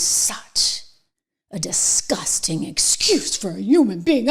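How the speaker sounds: hissed contemptuous aside; disgusted whisper. Real, not acted. Host insulting the Oxford debater, in a disgusted whisper.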